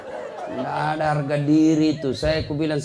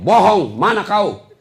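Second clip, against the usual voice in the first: louder, slow accusing snap; accusing wife; 5 semitones higher, 8 dB louder, slower than usual. Acted. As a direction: call him a liar. Wife accuses husband on phone.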